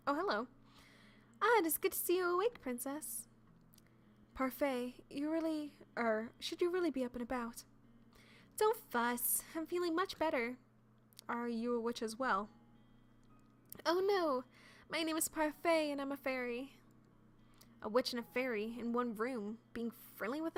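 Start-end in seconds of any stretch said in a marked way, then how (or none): 0.1-3.3 higher, bright friendly greeting; lightly voiced kind fairy; cheerful fairy. 13.9-16.7 higher, friendly fairy voice; lightly voiced sweet fairy; cheerful fairy. Fairy introducing herself.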